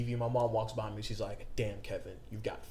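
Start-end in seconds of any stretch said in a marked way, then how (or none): 1.6-2.6 low, soft deadpan quote; deadpan mom imitation. Mom walking past her son watching TV, deadpan. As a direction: drop it quietly as mom's verdict. Mother's remark voiced in story.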